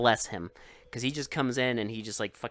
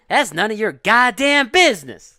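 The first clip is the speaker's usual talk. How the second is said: high, loud tough-guy snap; rude thug retort; 8 semitones higher, 12 dB louder than usual. Retold or imitated. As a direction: snap back like a rude goon.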